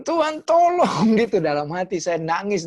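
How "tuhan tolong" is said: much higher, louder, slow cry for help; pastor's panicked inner prayer; desperate plea. Retold or imitated. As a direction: beg God for help, trembling. Desperate silent prayer while shaking.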